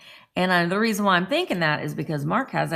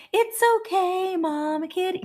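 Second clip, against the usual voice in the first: soothing the cat; 11 semitones higher than usual. Retold or imitated. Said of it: "and I'm like".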